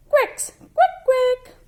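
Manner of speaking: high, loud quack quack; duck quacking. Shown as a demonstration.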